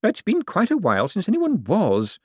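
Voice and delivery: put-on old Topsy sighs to Death, wry. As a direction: sigh it out as a dry old woman. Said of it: "sighed Topsy".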